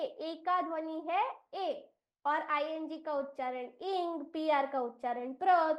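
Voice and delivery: ordinary delivery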